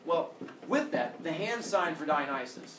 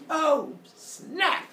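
high, loud alarm; acted high-pitched shock; shocked exclamation; 11 semitones higher, 5 dB louder than usual. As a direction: blurt it in comic dismay. Acted shock at falling baby.

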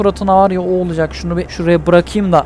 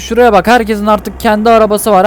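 ordinary delivery

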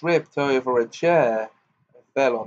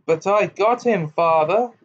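high, louder gloating cackle; cackling; 7 semitones higher, 5 dB louder than usual. A narrator's reading. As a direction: crow with glee over the hex. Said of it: "cackled Morphin".

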